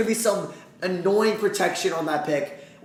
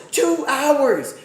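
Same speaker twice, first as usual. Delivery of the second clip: high and loud, a little less; exasperated yell again, slightly softer; 9 semitones higher, 4 dB louder than usual. Real, not acted. Streamer repeating his complaint to chat, still annoyed. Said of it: "I'm annoyed".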